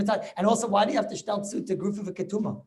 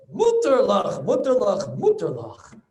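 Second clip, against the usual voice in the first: emphatic ruling; 6 dB louder, slower than usual. Retold or imitated. Rebbe acting Rashba's ruling.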